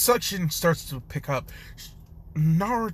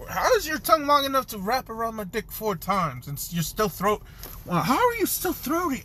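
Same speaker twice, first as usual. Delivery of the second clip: baffled disbelief; 7 semitones higher than usual. Acted. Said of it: "He's just like".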